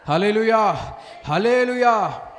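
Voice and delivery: building from loud to a full shout; shouted hallelujah. Real, not acted. Preacher rousing the congregation. (బిగ్గరగా అరిచే హల్లెలూయా)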